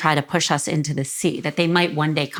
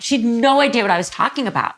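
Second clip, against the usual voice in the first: normal talking voice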